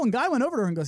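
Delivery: usual speaking voice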